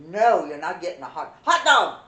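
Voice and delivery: loud, rising angry retold yell; retold angry shout. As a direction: yell it at the boy like she did back then. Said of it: "I said".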